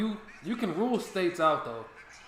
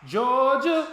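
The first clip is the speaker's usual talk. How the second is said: loud, high "Georgia" in disbelief; exaggerated mocking repeat of the guess; mock incredulous; 11 semitones higher, 11 dB louder than usual. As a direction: throw the friend's guess back at him.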